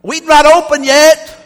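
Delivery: high, loud, drawn-out fake Spain accent; mock Spanish accent. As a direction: shout slowly in your best bad Spanish accent. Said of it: "that's my best accent".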